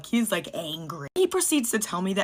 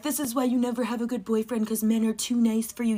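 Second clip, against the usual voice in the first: mocking commenter voice; 3 semitones higher than usual. Mocking the men in her comments, lightly voiced smug commenter. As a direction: scoff at her like a know-it-all commenter. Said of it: "I can already smell the men in my comments".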